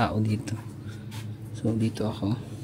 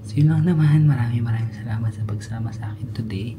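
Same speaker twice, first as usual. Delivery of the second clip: whispering; 4 dB louder than usual. Real, not acted. Whispering sign-off near sleepers. (pabulong na paalam)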